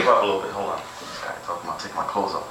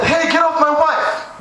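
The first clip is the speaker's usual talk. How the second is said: high, slow mock outrage; husband catching cheater; 13 semitones higher, slower than usual. The friend's husband yelling at the man in his wife's room, high mock outrage. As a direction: stretch it out up high, hammed-up. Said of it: "And then I said".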